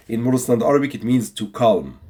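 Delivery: normal talking voice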